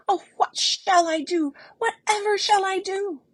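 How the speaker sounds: high, loud and fast panicked crying; desperate frightened wail; frightened sobbing plea. Acted. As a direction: beg in rising terror, near tears. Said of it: "sobbed again".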